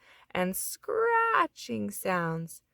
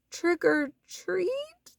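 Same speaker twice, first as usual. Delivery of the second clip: high, slow timid squeak; 9 semitones higher, much slower than usual. A narrator's reading. Froggy facing the wicked witch, timid squeak. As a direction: squeak it out nervously. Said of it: "squeaked Froggy".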